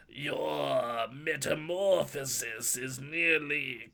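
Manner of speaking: slow, drawn-out alien bug voice; an insect creature announcing transformation, stretched out slow. Acted. Friend teasing his co-host in a slow insect voice. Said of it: "in an insect-like voice".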